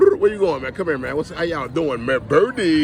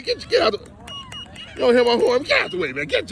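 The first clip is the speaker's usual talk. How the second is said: high, sharp shout to clear the road; shooing yell; faster than usual. Real, not acted. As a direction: holler at the birds to move. Honking at birds in road.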